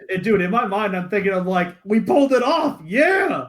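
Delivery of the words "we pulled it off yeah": higher, louder cheer of victory; a retold inner cheer, pitched up; triumphant. Silent triumph after staged slap.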